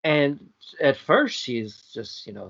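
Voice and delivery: usual speaking voice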